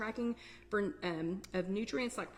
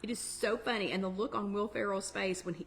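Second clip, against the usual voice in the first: normal talking voice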